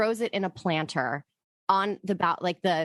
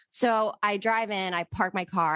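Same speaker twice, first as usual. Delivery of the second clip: usual speaking voice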